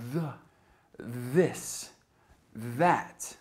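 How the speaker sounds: "the, this, that", each th buzzed with the vocal cords; voiced th examples. Shown as a demonstration. Teacher gives examples "the, this, that", voiced th, carefully separated. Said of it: "The voiced sound is made by vibrating".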